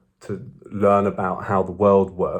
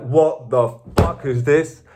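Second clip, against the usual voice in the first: baffled disbelief; 6 semitones higher, 4 dB louder, slower than usual. Retold or imitated. His baffled reaction to the intruders.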